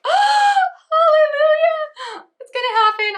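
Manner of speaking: high, loud cry of joy; overjoyed shout. Retold or imitated. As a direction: shriek it out like answered prayers. Overjoyed at delivery update.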